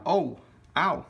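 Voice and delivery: real ouch from hot wax; burnt fingertips. Real, not acted. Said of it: "Oh, that's hot".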